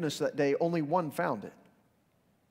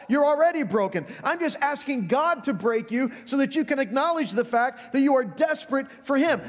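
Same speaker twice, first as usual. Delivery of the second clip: higher and louder, pressing each line home; impassioned preaching; 8 semitones higher, 4 dB louder than usual. Real, not acted. Pastor urging the congregation toward brokenness, preaching hard. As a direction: preach it with rising urgency. Preaching builds to full force.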